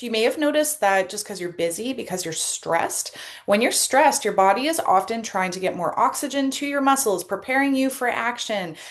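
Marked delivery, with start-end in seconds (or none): none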